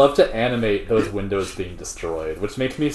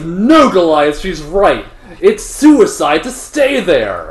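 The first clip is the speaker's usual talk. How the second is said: urgent pleading; 5 semitones higher, 6 dB louder, slower than usual. Retold or imitated. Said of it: "And he's like".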